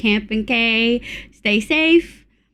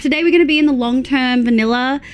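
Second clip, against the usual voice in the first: ordinary delivery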